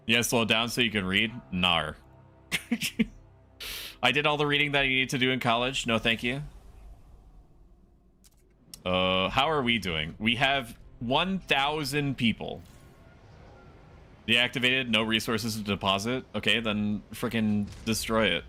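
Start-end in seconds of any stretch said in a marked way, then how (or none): none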